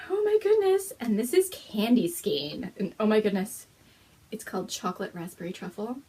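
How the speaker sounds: ordinary talk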